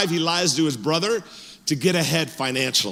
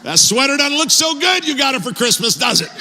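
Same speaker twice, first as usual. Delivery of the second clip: mock jab; 9 semitones higher, 3 dB louder than usual. Mocking the men's gifts.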